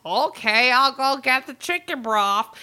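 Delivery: hint of a goofy obliging guy; Billy imitation. Billy obeying his fiancée's dad, lightly hammed-up. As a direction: agree like a dopey good sport. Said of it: "So Billy's like".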